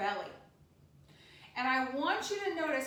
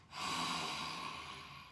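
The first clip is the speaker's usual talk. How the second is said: lion's breath exhale; 6 dB quieter than usual. Shown as a demonstration. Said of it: "Lion's breath".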